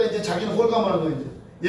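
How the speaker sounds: ordinary talk, nothing marked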